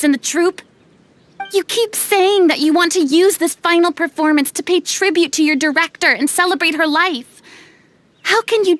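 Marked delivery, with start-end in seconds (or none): none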